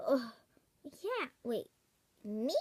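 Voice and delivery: usual speaking voice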